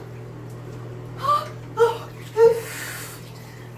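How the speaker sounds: high gasp breaking into a laugh; shocked gasp. Real, not acted. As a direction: gasp at the cold. Countdown before the head dunk.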